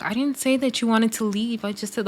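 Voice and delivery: imitating a soft-spoken housemate; quiet. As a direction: say it meekly, trailing away. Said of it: "Marissa talks so quietly".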